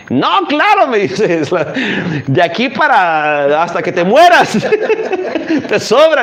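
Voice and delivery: high, laughing put-on woman's voice; mock prostitute. Retelling the parable to the congregation, a laughing mock woman's voice. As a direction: play the flirty woman, giggling through it.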